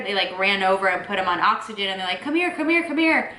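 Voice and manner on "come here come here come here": urgent call. Retold or imitated. Nurses calling him over.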